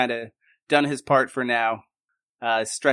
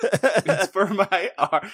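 laughing; 6 semitones higher, much slower than usual. Real, not acted. Repeats joke laughing.

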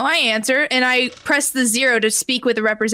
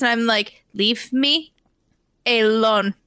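ordinary talk, nothing marked

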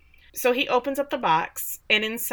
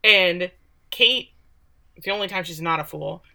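ordinary delivery

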